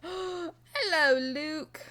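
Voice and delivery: loud, high British 'ello; put-on British accent, excited; mock British greeting. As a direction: greet with a playful British dropped H. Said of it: "I don't know why I said hello. I'm not, I'm not British".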